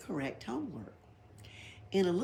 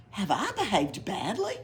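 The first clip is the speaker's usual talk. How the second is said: astonished; 4 semitones higher, 4 dB louder than usual. A narrator's reading. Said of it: "much astonished".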